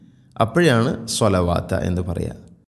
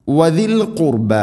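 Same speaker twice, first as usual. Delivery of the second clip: high, slow tajweed chant; Quranic recitation; 6 semitones higher, slower than usual. Shown as a demonstration. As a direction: recite it slowly as a model. Quran teacher models the recitation.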